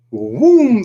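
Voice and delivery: loud, high drawn-out boom; booming wave crash. Acted. Storyteller as big wave hits boat, booming crash. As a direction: hit it like a wave slamming the boat.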